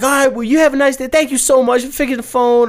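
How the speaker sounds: high, quick, chirpy thank-you; sweet lady goodbye. Retold or imitated. Ladies thanking the fake phone repairman, high and chirpy. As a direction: gush gratitude like a sweet office lady.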